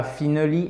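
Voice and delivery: "finally" with a short "fi" as in French "fini"; wrong French-style pronunciation. Shown as a demonstration. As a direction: say "finally" as "fee-nuh-lee". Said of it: "c'est pas finally, évidemment". (« finally » mal prononcé, « fi-ne-li » à la française)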